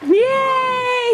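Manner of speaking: high, long squeal of praise; delighted high whoop; excited cheer. Real, not acted. Cheering the child.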